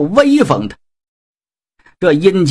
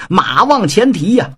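usual speaking voice

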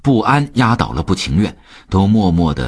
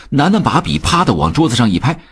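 usual speaking voice

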